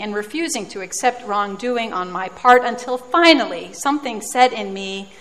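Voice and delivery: normal talking voice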